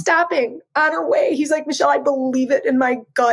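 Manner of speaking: high, tearful, breaking voice; pushing words out through tears; crying while talking. Real, not acted. Telling her sister the family story, voice breaking with tears. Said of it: "you're the crier today".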